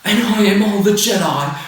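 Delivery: lightly voiced overblown heroine; mock Rey. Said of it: "she's like".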